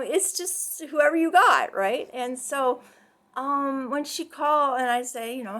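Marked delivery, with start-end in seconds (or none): none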